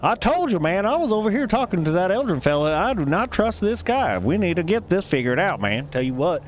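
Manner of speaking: high, fast halfling chatter; halfling warning the crowd about Eldrin; halfling fella. Acted. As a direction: gossip urgently like a suspicious halfling. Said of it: "one halfling fella's like".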